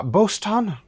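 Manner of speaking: put-on "Boston" suddenly in a broad American accent. Mimicking a Québécois dub for friends, American accent on Boston. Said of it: "ils le disent avec l'accent".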